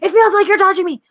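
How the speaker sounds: high, quick amazed insistence; acted-out rapid, high-pitched protest; insistent partygoer.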